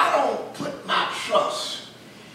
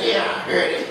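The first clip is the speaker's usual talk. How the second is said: put-on unbothered boy; 3 semitones lower, faster than usual. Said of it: "and said".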